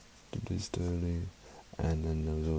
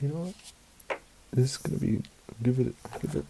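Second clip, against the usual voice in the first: ordinary delivery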